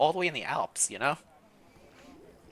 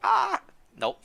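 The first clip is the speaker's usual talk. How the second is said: put-on old lady voice. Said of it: "an old lady voice".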